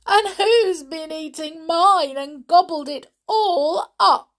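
put-on high, loud childish wail; weeping baby bear. A narrator's reading. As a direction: wail it as a wronged little bear. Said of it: "wept the baby bear".

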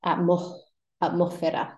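atmósfera with the S breathed out, atmohfera; aspirated S. Shown as a demonstration. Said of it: "It's breathed out instead of pronounced".

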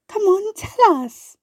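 high, slow coaxing plea; encouraging. A narrator's reading. Colibri urging the wasp to share, coaxing. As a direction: coax her gently, voice up high. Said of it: "Colibri encouraged her".

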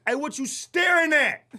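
mock street challenge from a friend; imitating Dante's challenge. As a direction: toss it out like picking a fight. Dante confronting staring driver.

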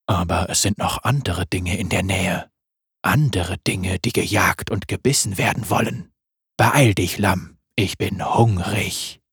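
langsam, tief und lauernd; tiefe Wolfsstimme. Acted. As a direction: ein Raubtier, das leise droht. Wolf voice again. (Wolf spricht tief und langsam)

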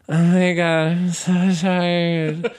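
high, loud, drawn-out whine; whining. Retold or imitated. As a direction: whine it like a spoiled kid who's exhausted. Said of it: "laying there whining".

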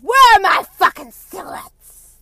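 imitated full-volume shriek; mom screaming. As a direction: scream it high and drawn out like a furious mom. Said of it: "Just like that".

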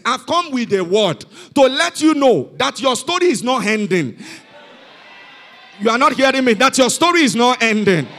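loud, ringing proclamation to the congregation; full-force preaching. Real, not acted. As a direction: drive the promise home loudly.

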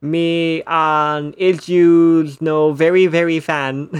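loud, slow, halting bad English. Joey trying to speak English to the American star, in loud, slow bad English. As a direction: belt out broken English slowly and haltingly. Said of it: "tries to speak bad English to him".

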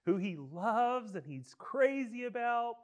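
ordinary delivery

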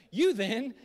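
loud, high, hammed-up English accent; grand put-on English accent; mock King James English. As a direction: proclaim it like old scripture in mock English. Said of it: "that was the New King James N I V version".

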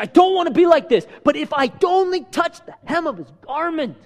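high, urgent retold outcry; desperate plea. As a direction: act out a desperate woman's resolve, pitched high. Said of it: "a desperation".

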